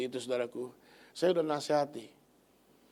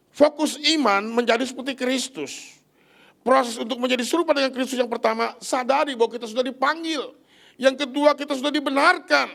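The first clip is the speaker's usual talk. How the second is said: higher and louder, driving each point home; full-force preaching; 6 semitones higher, 6 dB louder than usual. Acted. Preacher to the congregation, fervent full-voiced preaching. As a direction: proclaim it to the whole church.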